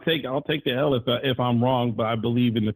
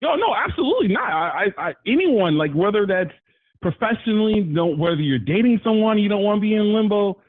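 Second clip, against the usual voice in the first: higher, rising, worked-up retelling of his words; excited imitation of Justin; 7 semitones higher than usual. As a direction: play him getting fired up about being left in limbo. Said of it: "he's like".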